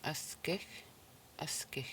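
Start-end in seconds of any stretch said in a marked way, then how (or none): 1.4-1.9 whispered, almost no voice. Shown as a demonstration. Whispered repeat of the word.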